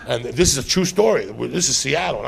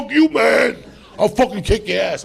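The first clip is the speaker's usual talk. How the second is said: tough threat; 9 semitones higher, 3 dB louder than usual. Retold or imitated. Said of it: "He's like".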